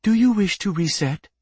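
high computer prompt; artificial female voice. A narrator's reading. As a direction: ask it as an artificial assistant. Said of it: "a familiar artificial female voice".

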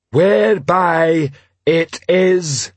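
slow, high, laboured troll reading; troll reading haltingly. Acted. Troll reading the act to a crowd, laboured proclamation. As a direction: read word by word, drawn out.

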